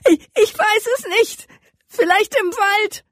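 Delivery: put-on a terrified captive boy crying out his answer; sobbing boy. A narrator's reading. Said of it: "schluchzte laut".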